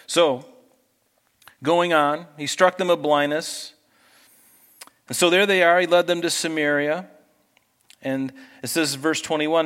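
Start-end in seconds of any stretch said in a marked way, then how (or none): none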